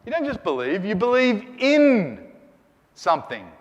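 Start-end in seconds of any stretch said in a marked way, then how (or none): 0.8-2.3 "in" drawn out long and loud; stress on IN. Shown as a demonstration. Teacher explaining belief to students, stress on "in". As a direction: lean hard on "in" and hold it.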